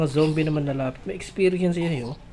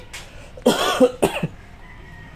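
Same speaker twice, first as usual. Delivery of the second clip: real coughing fit; 5 semitones higher than usual. Real, not acted. Streamer warning viewers before coughing, real cough. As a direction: cough hard, several times over. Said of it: "Uubo ako".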